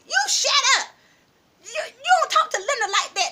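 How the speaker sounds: high, loud scolding, imitating friends; indignant shouting. Friends defend Linda against rival, imitated indignant shouting.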